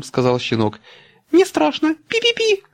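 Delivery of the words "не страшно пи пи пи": very high squeaky mouse voice; peeping baby chick. A narrator's reading. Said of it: "запищал мышонок". (пищащий мышонок)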